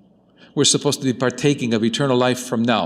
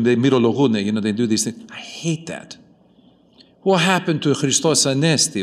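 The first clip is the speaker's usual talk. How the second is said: ordinary talk, nothing marked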